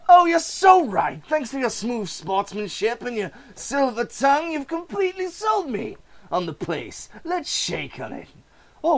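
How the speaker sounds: spring the trap with a smile; villain gloating over a swindled seller; smug villain's reveal. Acted. Voices seller and villain buyer.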